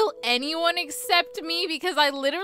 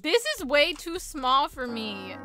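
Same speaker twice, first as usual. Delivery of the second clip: normal talking voice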